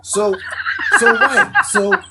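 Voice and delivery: excited laughing. Real, not acted. Excited laughing after the revelation.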